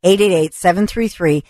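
upbeat sales pitch read; radio ad read. Acted. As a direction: sell the tour like a live radio spot.

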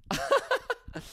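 short high falling chuckle; quick high laugh. Real, not acted. Comic chuckling at the song choice, a quick high laugh. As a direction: let a little laugh slip.

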